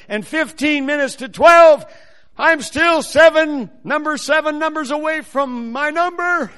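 loud, high, mounting alarm; anxious countdown. Retold or imitated. Preacher retelling his panic in the visa line, loud and anxious.

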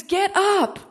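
voicing God's urgent charge; God's command. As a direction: command them to rise. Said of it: "He says".

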